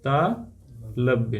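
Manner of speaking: तालव्य very slowly, split as ता, long pause, लव्य; slow dictation. Shown as a demonstration. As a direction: break तालव्य apart, low, for note-taking. Teacher dictates term in pieces. (तालव्य को बहुत धीरे, तोड़कर बोलें)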